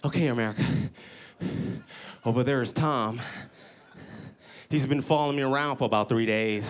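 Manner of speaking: low, hushed-pace narrator to camera; imitated documentary host. Bear man tells America about Tom, low host voice. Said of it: "he's like".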